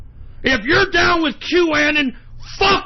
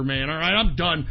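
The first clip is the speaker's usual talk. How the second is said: normal talking voice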